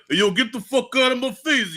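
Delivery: high-pitched New York gangster imitation; New York accent. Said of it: "he has a New York accent with it".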